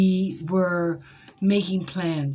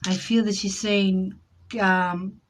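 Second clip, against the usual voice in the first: ordinary delivery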